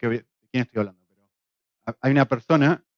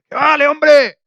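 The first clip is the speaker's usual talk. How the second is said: loud high shout of encouragement; shouted call; 9 semitones higher, 7 dB louder, much slower than usual. Retold or imitated. Worker shouting to his partner at the rope's end, loud high yell. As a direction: bellow it down the rope.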